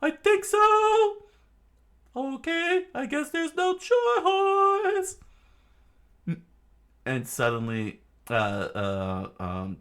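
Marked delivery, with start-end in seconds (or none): 0.0-5.2 very high, slow, half-sung reply; high sing-song character voice. Acted. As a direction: stretch it out in a tiny sing-song voice.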